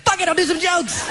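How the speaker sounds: high, reckless outburst; hammed-up drunken decision; tipsy resolve. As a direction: blurt it out high. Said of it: "eventually I had a couple more drinks".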